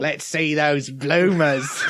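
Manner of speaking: slow leering mock cockney; leering cockney drawl; bad cockney accent. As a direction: drag it out in fake cockney. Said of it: "his bad accent".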